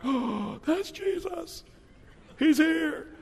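gasping high, slow amazement; as if you've just spotted Jesus walking in; breathless hushed wonder rising to a cry; awestruck surprise. Pretend. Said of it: "You're like".

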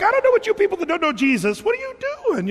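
high, laughing imitation of a carefree driver; high, amused voice of a man at peace; mimicking the driver. Pastor imitating a YouTube driver telling his wife.

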